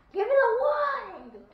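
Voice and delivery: high, loud, slow yell; loud demand. Real, not acted. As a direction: shout it at your partner. Demanding wood in game.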